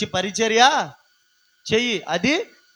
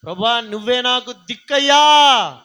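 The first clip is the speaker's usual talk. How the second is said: higher, louder cry for help to God; desperate prayer cry; 6 semitones higher, 6 dB louder than usual. Acted. Preacher acting a believer crying out to God, loud. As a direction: shout the plea to heaven. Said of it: "ప్రార్థించు".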